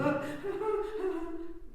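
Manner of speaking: high, loud cackle; mad old woman's laugh. Acted. Old countess laughing when called a mad old woman: cackle. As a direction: cackle at the very idea of madness.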